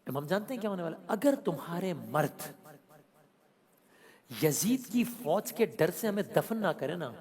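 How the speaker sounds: ordinary delivery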